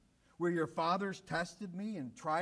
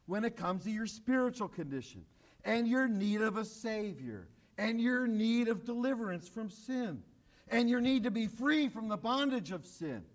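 usual speaking voice

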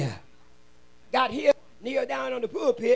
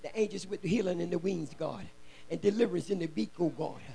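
low, soft pastoral prayer; hushed, rhythmic address to God; 3 semitones lower, 5 dB quieter than usual. Real, not acted. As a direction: pray quietly and steadily over the congregation. Leads a prayer.

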